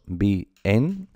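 bien as two syllables, bi-en, rising; split in two. Shown as a demonstration. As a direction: break bien into BI and EN, questioning. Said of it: "esto qué son? ¿Dos?".

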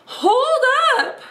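high cartoon cry of hold up; mock alarm. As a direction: stop them with a squeaky cry.

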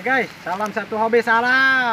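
loud cheerful outro shout; excited sign-off. Real, not acted. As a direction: call out the sign-off loudly. Vlog sign-off.

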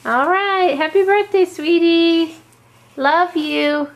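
loud happy birthday wishes; loud cheerful. Real, not acted. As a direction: call it out warmly.

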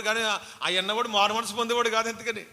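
loud, fervent pulpit delivery; full-force preaching. Acted. (గట్టిగా బోధించే స్వరం)

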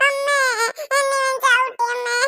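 put-on high wailing child's complaint; wailing to mother. Kicked child wails to her mother, high and tearful. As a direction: cry out to mother for help.

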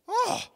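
loud, high-pitched excited "oh"; excited gasp. Retold or imitated. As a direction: imitate his excited, breath-filled outburst. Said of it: "he was more excited than you were".

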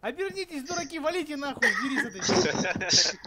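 high, slow, laughing warning; laughing panic. Real, not acted. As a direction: laugh while yelling warning. (смеющаяся паника)

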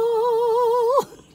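loud high laugh; high giggle burst. Real, not acted. Cracking up over the cookie, loud giggle. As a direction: break into giggles.

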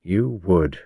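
ordinary delivery, nothing marked

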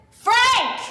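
impatient call. Acted. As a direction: shout his name, fed up. Mom calling husband, impatient.

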